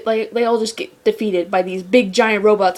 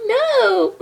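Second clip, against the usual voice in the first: anguished no; 12 semitones higher than usual. Retold or imitated. Shipper protesting the couple split, high wail. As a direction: cry out at the breakup. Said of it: "I'm just like".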